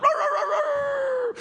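very high, drawn-out howl; dog howl. Acted. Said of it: "and then the other dog".

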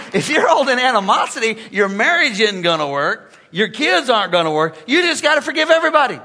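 loud, high preaching; preaching hard. Acted. Preacher urging the congregation to forgive, loud. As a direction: drive it home to the congregation.

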